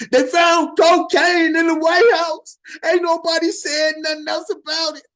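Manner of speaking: booming outraged rant; indignant shout. Real, not acted. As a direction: shout it out in disbelief. Ranting about double standards.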